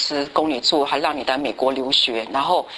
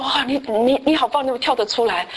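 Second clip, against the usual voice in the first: happy praise; 7 semitones higher than usual. Retold or imitated. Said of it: "就很高兴".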